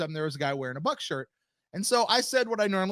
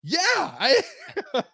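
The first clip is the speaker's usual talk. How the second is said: laughing reply; 10 semitones higher, 4 dB louder, slower than usual. Retold or imitated. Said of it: "And I went".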